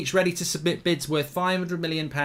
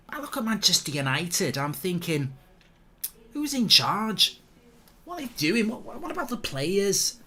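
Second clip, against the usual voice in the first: mock pundit rant; 5 semitones higher than usual.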